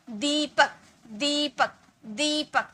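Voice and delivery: दीपक with the ई stretched long; drawn-out ई. Shown as a demonstration. Teacher showing students the long ई in दीपक, stretched. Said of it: "थोड़ा टान के ले रहे हैं ना".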